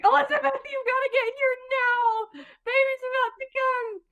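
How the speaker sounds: high-pitched mock panic; imitated urgent call. Mom calling Elizabeth in as baby comes, high urgent imitation. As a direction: act out a mom urgently summoning someone.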